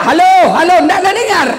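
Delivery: hammed-up villager shouting halo; mock shouting into phone. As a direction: yell into the wrong end of the phone.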